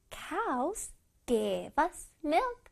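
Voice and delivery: slow follow-along reading. Shown as a demonstration. Teacher leading children through the sentence, slow and soft. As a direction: softly space out each word. Models sentence after "start" cue.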